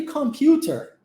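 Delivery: "computer" in a British accent, open ending; British-style final "er" in "computer"; British English. Shown as a demonstration. Teacher showing what you hear in Britain, British "computer". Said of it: "no inglês britânico".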